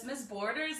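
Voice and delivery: ordinary delivery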